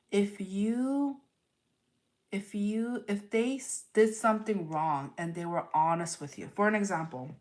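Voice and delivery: ordinary talk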